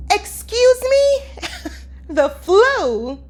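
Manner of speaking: high, loud, slow "Excuse me?" in disbelief; shocked disbelief. Retold or imitated. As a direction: pretend to be floored by the news, pitching way up. Stunned at being told flu.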